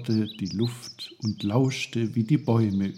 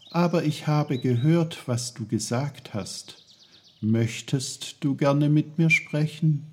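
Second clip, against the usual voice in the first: higher-voiced, eager young boy; polite boy Peter; 6 semitones higher than usual. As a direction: ask politely in a light, high boy's voice. Peter's voice in story dialogue.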